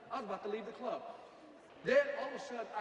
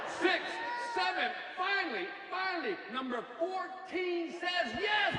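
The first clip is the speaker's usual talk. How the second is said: building triumph; 10 semitones higher, 5 dB louder, slower than usual. Acted. Counting rejections to the triumph.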